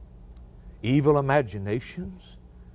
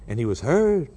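normal talking voice

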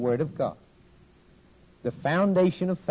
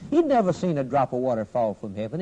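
ordinary talk

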